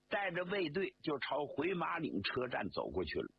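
usual speaking voice

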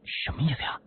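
high, puzzled murmur to oneself; puzzled inward mutter. A narrator's reading. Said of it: "我心里嘀咕着".

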